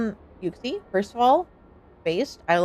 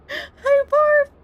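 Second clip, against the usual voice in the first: squeaked greeting; 4 dB louder than usual. Real, not acted. Greeting someone while cracking up.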